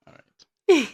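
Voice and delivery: embarrassed laugh. Real, not acted. Teased about married couple.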